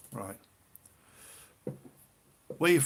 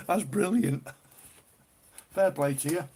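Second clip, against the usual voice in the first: ordinary delivery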